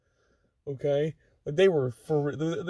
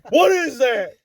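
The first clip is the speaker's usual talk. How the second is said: yelling; 4 semitones higher, 6 dB louder than usual. Retold or imitated. Retold drill sergeant yelling.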